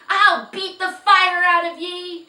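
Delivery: high, very loud raging shout; shouting in a rage. Acted. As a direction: roar the threat in fury. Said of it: "shouted Jiggy in a rage".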